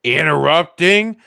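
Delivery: high loud indignant yelp; mock outrage. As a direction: act shocked at the interruption.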